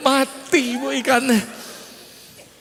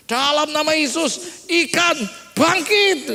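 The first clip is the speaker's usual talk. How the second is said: raised, solemn command in prayer; acted miracle-working preacher; mock resurrection prayer; 6 semitones higher, 5 dB louder, slower than usual. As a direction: command a miracle, stretching out the last words. Acts out praying over dead fish.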